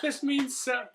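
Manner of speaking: high-pitched mock choked-up voice; put-on weepy squeal; imitated happy crying. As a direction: gush as if tearfully moved, exaggerated. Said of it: "She actually started crying".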